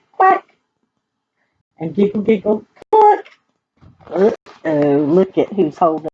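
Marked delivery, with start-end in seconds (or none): 0.1-0.6 high, loud little quack; duck quack. Acted. The duck quacks back at the farmer. Animal sound in story refrain. 2.9-3.3 high, loud hen cluck. Acted. The hens cluck back at the farmer. Hen sound in story refrain.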